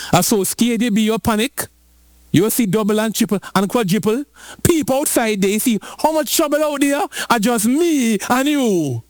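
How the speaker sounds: high-pitched, worried pleading; alarmed servant. Acted. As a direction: act out a panicky servant appealing to his master.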